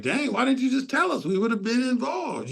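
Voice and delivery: high, playful mock complaint; mock exasperation. Host teasing the rival summit group, high mock exasperation. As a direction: toss it up high like a friendly gripe.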